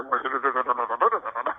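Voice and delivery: short amused laugh. Real, not acted. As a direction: laugh it off in disbelief. Laughs at the prosecutor's stammering.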